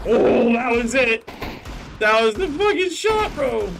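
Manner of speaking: shouting in triumph; excited shout. Real, not acted. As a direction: yell it out, thrilled. Celebrating a great shot.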